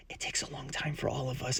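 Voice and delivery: soft unvoiced whisper of comfort. Classmate quietly whispering comfort to Peter, hushed. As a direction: breathe it like a secret.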